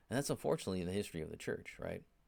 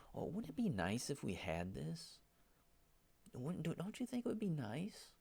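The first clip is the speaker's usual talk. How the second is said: high, syrupy wheedling question; mock passive-aggressive; 7 semitones higher than usual. Churchgoer hinting instead of asking straight, high wheedling mock voice. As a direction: coax sweetly while pushing your own wish. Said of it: "really they're being passive aggressive".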